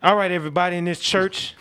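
high, loud call to the room; loud announcement. Acted. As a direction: command the whole church hall. Bursting into a church hall.

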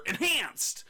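"Enhanced" drawn out and punched like all capitals; emphatic ENHANCED. Real, not acted. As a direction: announce "Enhanced" as if it ends in an exclamation point. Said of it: "I like the way you say enhanced".

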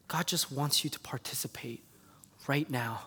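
whispered, soft and unvoiced; intimate whisper. Real, not acted. Preacher pressing the congregation, hushed whisper. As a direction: lean in and whisper the point.

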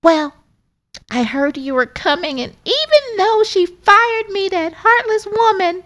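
very high, hurt, squeaky voice; Lexi. Acted. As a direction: complain about her boss in a squeaky voice.

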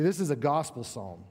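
ordinary delivery

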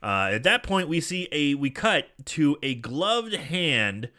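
ordinary talk, nothing marked

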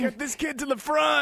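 high, loud goblin holler; playful urgent yell; mock rallying shout. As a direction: call out to the crowd, hammed up. Acted goblin calling out.

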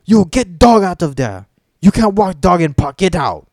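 loud, high, clipped imitation of a rude worker; mock rude park worker. Retelling the park cleaner ordering her out, loud mock bark. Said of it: "comes up to me and, and says".

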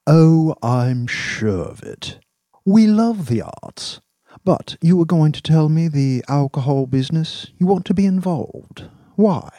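leisurely, theatrical man of taste; suave, probing boss. Acted. Voices Dax, suave and probing.

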